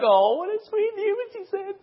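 very high, cooing voice of a melted dad; doting dad. Retold or imitated. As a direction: coo it like a softened father. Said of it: "He's like".

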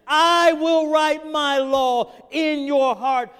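slow, loud, high proclamation of an angel; commanding angel voice, loud and slow; angel speaking. Acted. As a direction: thunder it out as the angel of the covenant. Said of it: "the angel of the covenant comes down and he says".

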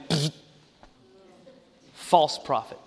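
dismissive low scoff. Retold or imitated. Pastor retells his own reaction to the preacher, scoffing. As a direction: brush him off with contempt. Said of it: "And I went".

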